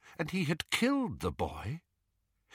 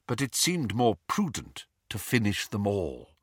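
usual speaking voice

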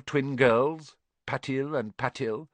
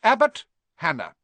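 higher, louder stern teacher's call; McGonagall announcing; 7 semitones higher, 5 dB louder than usual. A narrator's reading. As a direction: announce it crisply to the new students. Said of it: "McGonagall calls names".

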